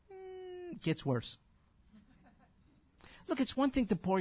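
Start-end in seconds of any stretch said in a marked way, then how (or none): none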